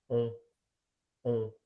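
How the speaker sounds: rounded nasal "on" through the nose. Shown as a demonstration. Teacher repeating nasal "on" for students. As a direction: round lips, hum "on". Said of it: "Let's do it".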